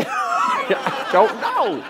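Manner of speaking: slow, high, laughing "I don't know"; laughing shrug. Retold or imitated. Laughing reply to his wife.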